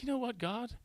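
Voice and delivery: retold prayer, pitched high and sulky; mock jealous plea to God. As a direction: ask God high and hurt, like a left-out kid. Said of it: "and I was like".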